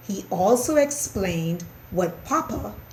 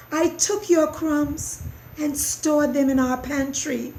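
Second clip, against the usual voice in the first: confessing mouse; 7 semitones higher than usual. A narrator's reading. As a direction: confess it in a small squeaky voice.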